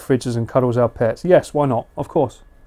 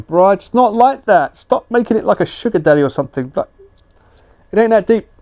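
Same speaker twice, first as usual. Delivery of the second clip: playful protest; 6 semitones higher than usual. Real, not acted. Protesting chat joke.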